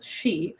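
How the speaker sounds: "she" said plainly like English she; Irish word pronunciation. Shown as a demonstration. Teaching viewers how to say the Irish word, "she". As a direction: say "she", not the spelled Sidhe. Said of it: "but it's pronounced she".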